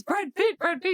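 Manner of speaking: high, eager mock fan calls; imitated excited fans. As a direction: call out like star-struck picture hunters. Imitates people wanting pictures with dad.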